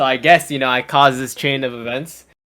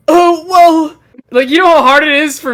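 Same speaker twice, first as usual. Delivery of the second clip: much higher and louder, put-on squeak; flustered excuse; 11 semitones higher, 8 dB louder than usual. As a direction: caught off guard in front of the teacher, voice jumps up. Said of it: "And I was like".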